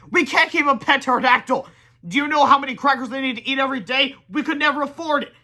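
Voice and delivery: high, loud stern voice; stern scolding. A narrator's reading. Boy sternly scolding friend about the pet. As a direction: scold him firmly. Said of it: "said George sternly".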